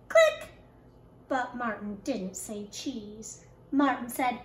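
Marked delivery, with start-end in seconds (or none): 0.1-0.5 high, louder click sound; bright mouth click; camera click. Pretend. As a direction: snap the shutter with a click. Pretend camera shutter noise.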